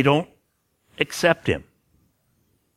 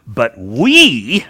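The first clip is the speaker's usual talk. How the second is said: "But we" with we punched louder; stress on we; 3 semitones lower, 6 dB louder than usual. Shown as a demonstration. Preacher reading scripture, stressing "we" loudly. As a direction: lean hard on "we". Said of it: "get that emphasis on the".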